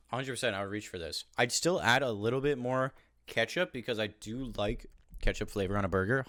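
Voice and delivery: ordinary delivery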